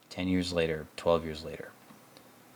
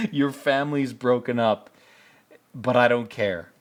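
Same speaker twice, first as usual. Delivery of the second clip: mock cold dismissal; 3 semitones higher than usual. Rust waving off Marty's family talk, mocking high voice. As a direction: sneer it away mid-laugh. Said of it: "He's like".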